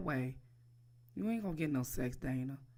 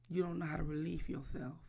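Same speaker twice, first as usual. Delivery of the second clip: normal talking voice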